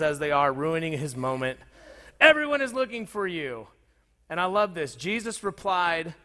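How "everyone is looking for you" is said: high-pitched call breaking in; exclaiming. Acted. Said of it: "they exclaimed".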